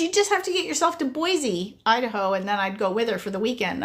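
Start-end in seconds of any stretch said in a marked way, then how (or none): 0.0-2.3 higher, eager inviting friend. Retold or imitated. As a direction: coax her to come along. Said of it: "She goes".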